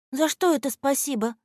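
high, puzzled question; недоумение. A narrator's reading. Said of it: "не поняла Аля". (недоумённо, высоким голосом)

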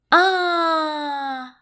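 loud high-pitched scream of terror; terrified scream. A narrator's reading. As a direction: scream in sudden fright. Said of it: "заорала Женька".

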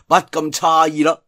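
usual speaking voice

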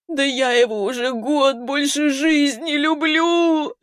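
high, drawn-out tearful wail. A narrator's reading. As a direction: howl it out in anguish. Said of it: "завыла Вера".

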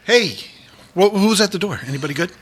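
pumped-up bodybuilder host; fitness host character. Pretend. Host playing Grafilla Gorilla character.

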